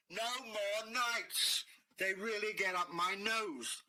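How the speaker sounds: slow, high, stretched-out dragon call; dragon's parting demand. Acted. Dragon to the mayor, slow drawn-out demand. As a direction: draw it out like a grumpy dragon.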